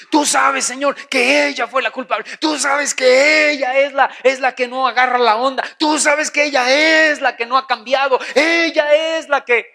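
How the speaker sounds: high, loud, insistent blaming; accusing complaint. Acted. Husband complaining to God that his wife is to blame, accusing. Acts a spouse blaming in prayer.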